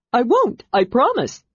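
high, bright eager promise; a small creature swearing loyally; eager Kender. A narrator's reading. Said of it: "said the Kender".

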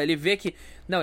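ordinary delivery

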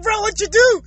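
high-pitched, amused disbelief; incredulous retold question. Asking his buddy what happened next, high and incredulous. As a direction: pitch it up, demanding the details. Retelling eager question to friend.

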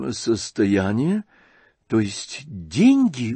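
медленно, лёгкое удивление; переспрашивает с недоумением; удивлённо. Acted. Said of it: "удивился князь". (князь удивлённо и медленно переспрашивает)

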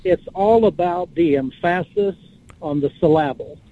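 "emphasis" stressed on its middle, em-FASS-is, "syllable" as suh-LAB-le; misplaced syllable stress. Shown as a demonstration. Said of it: "have you ever heard this said?".

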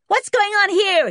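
put-on loud, high-pitched indignant boy; startled outrage. A narrator's reading. Junior woken by a crash, loudly indignant. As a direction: burst out, high and cross.

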